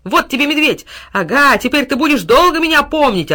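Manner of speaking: high, loud, exhilarated taunts; excited battle cries; gleeful fighting shout. A narrator's reading. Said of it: "азартно кричал пёс".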